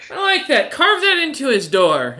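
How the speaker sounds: high, loud delighted voice; gleeful Rain. Acted. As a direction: pitch it up, relishing the cruel joke. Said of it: "giggles again, and she goes".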